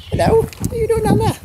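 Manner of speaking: high, cooing pet voice. Real, not acted. Owner greeting his cat in his arms, high cooing.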